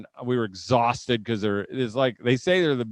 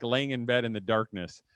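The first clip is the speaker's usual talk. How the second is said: ordinary delivery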